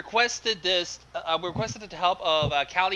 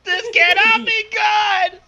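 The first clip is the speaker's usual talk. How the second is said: much higher, loud wailing cry; hammed-up anguished no; 9 dB louder than usual. Friend hearing the fanfic title, wailing in mock agony. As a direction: howl it like a doomed hero.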